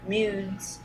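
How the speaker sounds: ordinary talk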